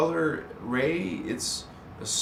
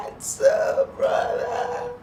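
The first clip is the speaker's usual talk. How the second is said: very high squeaky voice; squeaky greeting; 5 dB louder, slower than usual. Pretend. Greeting a viewer in a squeaky high voice. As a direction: squeak it out playfully.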